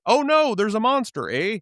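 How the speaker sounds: put-on high polite Canadian kid voice; chirpy Canadian kid accent, polite terror. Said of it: "the kids had that unmistakable Canadian accent".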